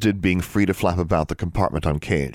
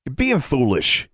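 snapping; 7 semitones higher than usual. A narrator's reading. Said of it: "he snapped".

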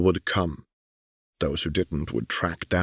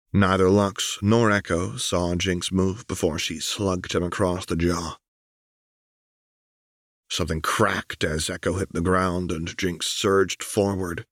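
ordinary delivery, nothing marked

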